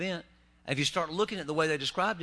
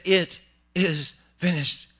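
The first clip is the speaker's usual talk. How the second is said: slow solemn words; 6 dB louder, much slower than usual. Acted. Jesus's last words read slowly.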